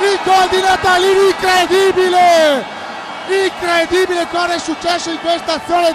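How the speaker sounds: excited shouted goal call; breathless italian goal commentary. Real, not acted.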